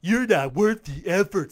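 loud mocking echo; mocking quote. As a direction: parrot the insult back with scorn. Mockingly quotes commenter back.

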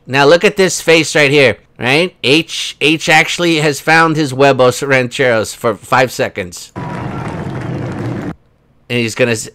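ordinary talk

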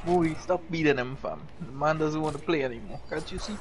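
low soft fake soothing; mock consolation. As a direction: pretend to comfort a crybaby. Mock pleading for the loser.

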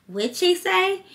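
high, nosy mock question; lightly voiced gossiping woman; imitating the lady. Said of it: "she was like".

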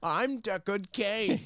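put-on high, slow caricature of an old man; Deckard Cain. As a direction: announce yourself in a drawn-out old guy voice. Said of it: "do an old guy voice".

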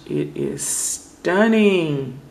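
loud, high, drawn-out rave; awed delight. Real, not acted. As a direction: stretch the last word right out. Gushing over the polish color.

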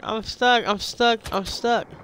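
high-pitched self-imitation of being stuck; mock complaint. Player replaying being stuck to friends, mock complaint. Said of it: "I'm like".

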